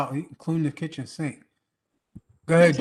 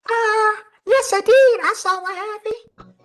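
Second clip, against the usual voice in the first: much higher, louder, slow squeaky witness; mocking witness voice; 11 dB louder, slower than usual. Friend mimicking a bystander answering the police, high squeaky voice. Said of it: "This is how he said it".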